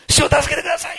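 high, loud cry to the Lord; desperate shout for help. Retold or imitated. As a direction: call out for rescue as if sinking. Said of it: "ってこうすぐ叫んでる".

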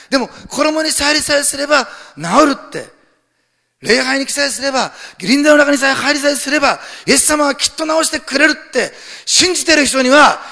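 high, loud, impassioned proclamation; full-force preaching. Real, not acted. Pastor proclaiming the sick woman's faith, impassioned preaching. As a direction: hammer each phrase out with rising fervour.

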